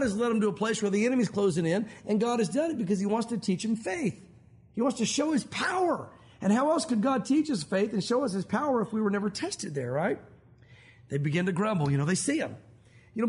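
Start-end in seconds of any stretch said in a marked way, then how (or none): none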